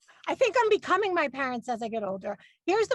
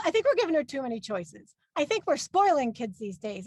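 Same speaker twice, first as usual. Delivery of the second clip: usual speaking voice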